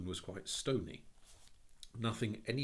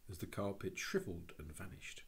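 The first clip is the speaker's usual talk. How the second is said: plain reading voice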